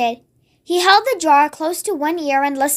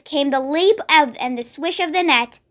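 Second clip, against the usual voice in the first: plain reading voice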